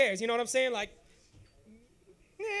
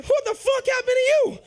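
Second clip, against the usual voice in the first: much higher, loud alarmed mother; shocked mom; 10 semitones higher, 7 dB louder than usual. Retold or imitated. Mother seeing her bruised son in the morning, loud alarm.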